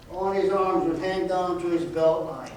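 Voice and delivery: usual speaking voice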